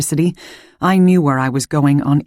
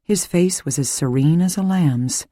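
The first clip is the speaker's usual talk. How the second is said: ordinary delivery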